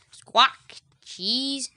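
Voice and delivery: high, loud parrot squawk and word. Acted. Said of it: "repeats Polly".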